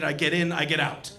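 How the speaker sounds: ordinary delivery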